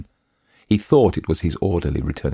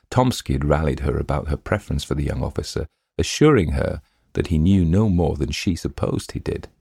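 ordinary delivery

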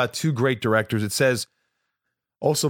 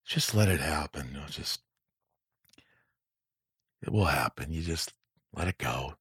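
imitating Eastwood's quiet coaxing; soft low imitation; 7 semitones lower, 7 dB quieter than usual. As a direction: ease the actor in like a gentle old director. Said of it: "He goes".

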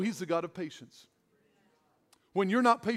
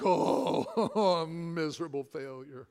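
mock despair; 5 semitones lower, 4 dB quieter than usual. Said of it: "And I'm like".

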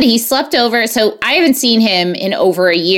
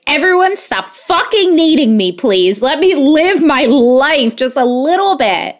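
fed up, higher-pitched begging; acted-out overwhelmed outburst; exasperated plea; 6 semitones higher than usual. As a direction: plead to be left alone, at your wits' end. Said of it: "I hate that feeling where you're just like".

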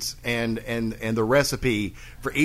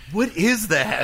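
mock surprise; 9 semitones higher, 4 dB louder, slower than usual. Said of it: "Barb was like".

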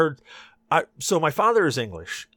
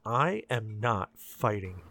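slow; 3 semitones lower, 5 dB quieter, much slower than usual. Retold or imitated. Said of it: "I'm like".

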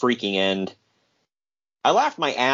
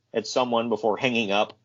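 normal talking voice

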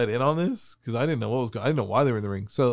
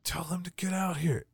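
hushed urgent; 4 semitones higher, 9 dB quieter than usual. Retold or imitated. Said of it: "they're like".

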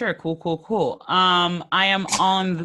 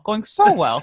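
louder ironic "Going so well"; sarcastic; 3 semitones higher, 4 dB louder than usual. Real, not acted. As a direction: say "Going so well" with mock brightness.

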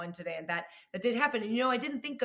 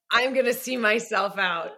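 embarrassed exit line; 2 semitones higher, 8 dB louder than usual. Retold or imitated. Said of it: "And I was like".

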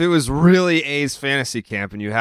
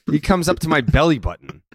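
ordinary talk, nothing marked

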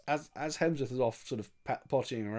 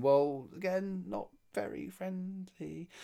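slow, soft, higher mock objection; imitating uneasy hero; 6 semitones higher, 4 dB quieter, slower than usual. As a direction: draw out the hero's timid protest. Repeats hero's objection in mimicry.

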